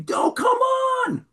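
a heart crying out to keep going; inner shout. Acted. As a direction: burst out with it. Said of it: "In his heart, he's saying".

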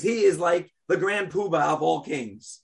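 ordinary talk, nothing marked

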